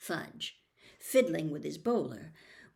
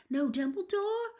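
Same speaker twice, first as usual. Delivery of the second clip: put-on alarmed boy; 7 semitones higher than usual. A narrator's reading. Ron's alarm.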